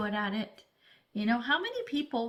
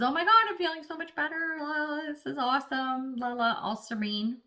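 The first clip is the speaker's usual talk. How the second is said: higher, faster, hammed-up sigh of relief; mock relief; 5 semitones higher than usual. Reader imitates the relieved client after the Nine of Cups, mock bliss. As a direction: play the client suddenly feeling lighter, a touch sing-song.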